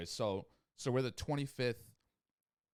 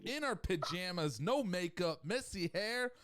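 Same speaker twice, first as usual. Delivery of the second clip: promo announcer voice; 7 semitones higher than usual. Pretend. Hyping the show like a promo.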